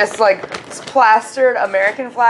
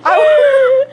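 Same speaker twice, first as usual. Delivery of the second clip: mock hurt; 8 semitones higher, 3 dB louder than usual. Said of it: "pretending to be the insulted baker".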